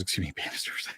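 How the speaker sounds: soft, breaking into a real laugh; laughing at own slip. Real, not acted. Speaker correcting a botched family name, laughing at the slip. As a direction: giggle quietly through the correction.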